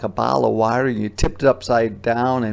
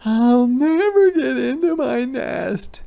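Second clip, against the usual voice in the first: high, slow, whiny hedgehog voice; squeaky hedgehog despair; upset hedgehog; 13 semitones higher, 5 dB louder than usual. Acted. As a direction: moan it like a put-upon little animal.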